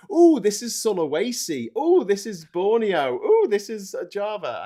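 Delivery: put-on high, loud, childlike delight; gasping with geeky joy at each island; ooh-ing over every place. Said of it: "I'm like".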